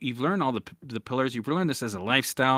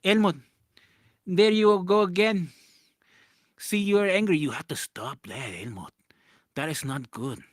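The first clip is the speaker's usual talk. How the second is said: imitating mom; 4 dB louder, slower than usual. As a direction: nag him like his mother, slowly. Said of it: "She's like".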